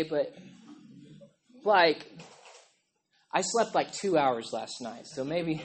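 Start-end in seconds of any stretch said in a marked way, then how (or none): none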